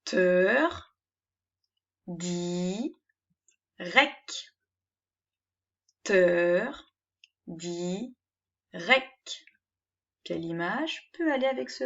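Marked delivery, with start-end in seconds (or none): 0.0-4.5 each syllable "Teur, di, rec" said apart with pauses; "Teur, di, rec" read as three syllables. Shown as a demonstration. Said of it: "lisez les syllabes de ce nouveau mot". 6.0-9.4 each syllable "Teur, di, rec" said apart with pauses; "Teur, di, rec" read as three syllables. Shown as a demonstration. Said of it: "lisez les syllabes de ce nouveau mot".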